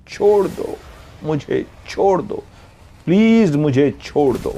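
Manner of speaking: high, pleading cry to be let go; a frightened girl begging her captors; desperate pleading. A narrator's reading. Captive girl begging to be freed.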